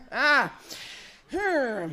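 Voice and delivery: usual speaking voice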